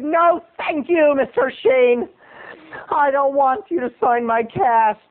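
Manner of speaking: high, stretched-out mock indignation; indignant refusal. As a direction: refuse grandly in a high, slow voice. Acted indignant refusal to the star.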